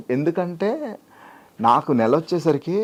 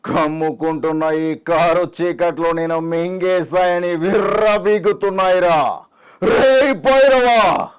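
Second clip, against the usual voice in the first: slow declamation building to a high, loud roar; film-star impression; 4 semitones higher, 3 dB louder, slower than usual. Mimic calls out to Bhairava in a film dialogue, slow roaring declamation. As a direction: draw it out, then bellow the name. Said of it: "[name]".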